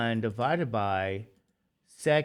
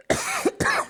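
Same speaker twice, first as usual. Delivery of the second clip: sudden choking cough; coughing fit. Real, not acted. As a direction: cough hard, caught in the throat.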